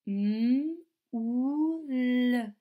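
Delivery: "M ou l" slowly, each sound held apart and stretched; teacher slowly sounding out "M ou l". Shown as a demonstration. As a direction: draw out "M", "ou", "l" one by one, slowly.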